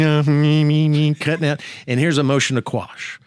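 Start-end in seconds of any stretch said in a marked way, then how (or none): 0.2-1.1 drawn-out "me, me" machine whine; fax machine noise. Retold or imitated. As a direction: imitate a humming fax machine. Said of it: "that fax machine starts humming".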